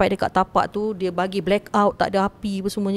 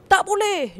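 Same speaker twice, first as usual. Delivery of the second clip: much higher, curt refusal as the spirit; angry refusal; 12 semitones higher, slower than usual. Retold or imitated. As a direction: snap it high as the angry spirit. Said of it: "dia marah". (tolak dengan marah, suara sangat tinggi)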